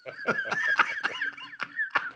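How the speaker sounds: high, real laugh; punchline laughter. Real, not acted. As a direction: crack up after the joke. Laughs after own punchline.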